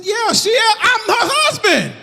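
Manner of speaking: high, loud indignant husband; indignant shout. Retold or imitated. Husband tells the man off about his wife, shouting up high.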